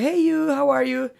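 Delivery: high, drawn-out mock greeting; retold call back. Lance answering a stranger outside a bar, imitated call. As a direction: imitate calling cheerily back across a street. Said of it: "Lance ropade tillbaka såhär".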